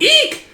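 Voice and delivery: very high little eek; startled squeal. Acted. Young miss startled from behind, squealing. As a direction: squeal as if crept up on.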